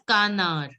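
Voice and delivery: canard ending on r with no d; canard, silent D. Shown as a demonstration. Teacher showing how duck is said, canard without D. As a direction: drop the D from canard. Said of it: "Don't pronounce the D".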